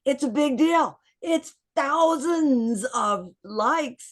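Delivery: high, loud, thrilled announcing; rally the group with big news; high excited call, slowing right down at the end; excited announcement. Retold or imitated. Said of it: "but I was like".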